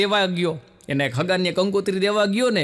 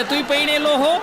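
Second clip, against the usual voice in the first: mock surprise; 7 semitones higher than usual. Woman shocked he is married too.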